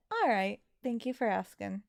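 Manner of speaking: ordinary talk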